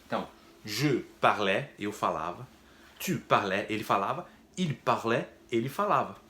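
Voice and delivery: je parlais said as French /ʒə paʁlɛ/, final S silent; French conjugation demo. Shown as a demonstration. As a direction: model je parlais, tu parlais, il parlait clearly. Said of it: "em francês ficaria assim". (pronúncia francesa de je parlais)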